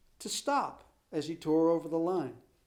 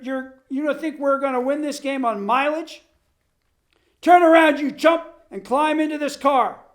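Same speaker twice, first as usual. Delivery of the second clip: much higher, louder, yelling tirade at the runner; furious coach; 8 semitones higher, 8 dB louder than usual. Acted. Coach yelling at his star runner from the car, furious. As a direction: scold him like a red-faced coach chasing a car. Coach Bost berating Ole.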